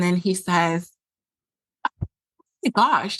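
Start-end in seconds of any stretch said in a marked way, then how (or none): 1.9-3.2 mock a dramatic partner's exclamation; imitating partner's drama. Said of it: "I'm gonna do my best, like, impression".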